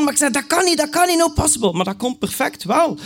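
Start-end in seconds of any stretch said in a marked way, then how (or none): 0.3-1.7 panicked. Retold or imitated. Panicked reply on phone.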